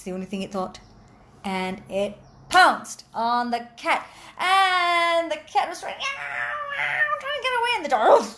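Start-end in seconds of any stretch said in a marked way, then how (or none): 4.4-8.3 frantic narration. A narrator's reading. Narrator to children, cat fleeing dog, frantic and much higher. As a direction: voice jumps much higher in mock alarm. Dramatic telling of the fight.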